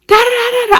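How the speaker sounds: high-pitched mocking babble; mock going off. Teller mimics the girl's forgotten rant, mocking. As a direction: imitate a rant in high nonsense syllables. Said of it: "she was going off".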